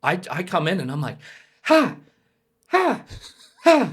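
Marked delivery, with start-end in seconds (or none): 1.6-3.9 high, separate gasps of indecision; comic torn panting; repeated frantic gasps. Retold or imitated. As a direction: gasp sharply, turning back and forth. Torn, looking back and forth.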